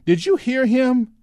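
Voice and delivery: very high, loud, drawn-out outrage; high-pitched indignation. A narrator's reading. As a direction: let the voice climb in disbelief. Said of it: "voice had risen yet higher in indignation".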